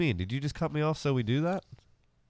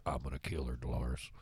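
low muttered threat; 11 semitones lower, 13 dB quieter than usual. Acted. S1 voices neighbour.

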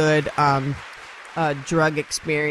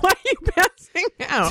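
high mocking guy voice; 12 semitones higher than usual. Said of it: "he was like".

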